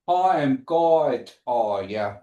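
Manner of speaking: "Hi, I'm God, are ya?" traag en nadrukkelijk; slow demo. Shown as a demonstration. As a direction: stretch out "Hi, I'm God" then drop for "are ya?". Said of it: "Y'all repeat that for me".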